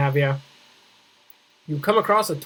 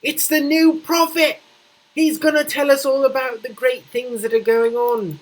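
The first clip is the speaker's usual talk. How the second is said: excited; 6 semitones higher than usual. Acted. Excited praise of the prophet.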